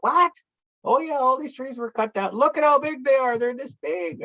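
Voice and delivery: put-on high-pitched kids' disbelief; acted astonishment. Kids learning the land was logged, imitated in high surprise. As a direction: imitate youngsters suddenly catching on, starting with a sharp high yelp.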